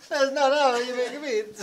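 laughing mock Italian. Echoes the pizza line.

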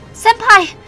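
put-on high-pitched girl's shout; high panicked cry. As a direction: cry out in alarm. Girl cries to her master.

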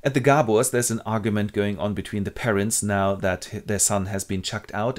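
normal talking voice

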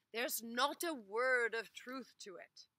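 loud, brushing it off in a put-on voice; dismissive denial. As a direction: wave the accusation away. Ivan denies to master.

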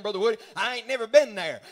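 ordinary talk, nothing marked

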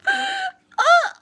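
high-pitched squeal of gushing delight; retold gushing, very high and loud, easing off toward the end; gushing reaction. Retells gushing at sweet message.

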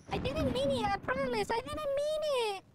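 pleading apology. Real, not acted. Player apologising to a teammate he killed, pleading. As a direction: whine out a protest of innocence.

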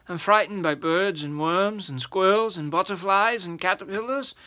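a big giant sighing out his fears, mildly played. A narrator's reading. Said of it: "the giant sighed".

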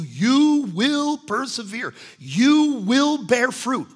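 high, loud and slow, each word hammered; preaching climax. Real, not acted. Preacher promising the congregation, loud and slow. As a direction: proclaim it to the whole church. Preacher drives the point home.

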